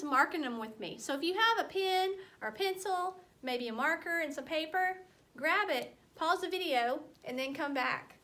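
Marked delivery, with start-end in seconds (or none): none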